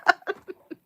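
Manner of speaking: bright real laugh; a quick genuine laugh; amused laugh. Real, not acted. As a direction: laugh freely at the chat. Live chat giveaway banter.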